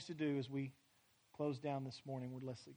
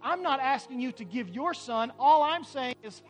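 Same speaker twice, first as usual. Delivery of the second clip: preaching at full force; 8 semitones higher, 9 dB louder than usual. Acted. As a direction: build the sermon to a shout. Climax of the sermon.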